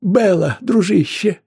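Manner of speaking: high, loud, alarmed shout; crying out. A narrator's reading. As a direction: cry out to a fallen friend. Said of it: "закричал книжный агент". (высокий, встревоженный крик)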